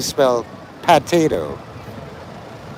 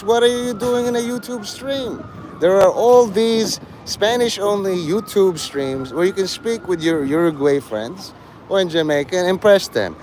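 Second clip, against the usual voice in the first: ordinary talk, nothing marked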